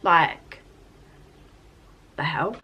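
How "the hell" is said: ordinary talk, nothing marked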